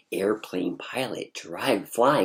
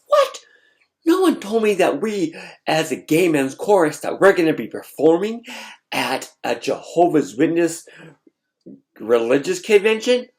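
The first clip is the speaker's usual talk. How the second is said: much higher, loud, shocked outburst; 6 semitones higher, 7 dB louder than usual. Retold or imitated. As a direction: burst out in stunned disbelief.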